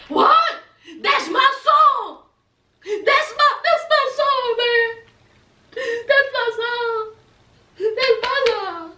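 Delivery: high, overexcited cry fading out; mock being overcome by a favourite song; too excited. Man pouting outside hears his song, tearfully excited. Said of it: "He was too excited".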